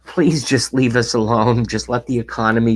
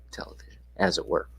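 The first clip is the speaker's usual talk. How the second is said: whispered aside; 4 semitones lower, 9 dB quieter, faster than usual. Acted. Mock aside whisper on stream.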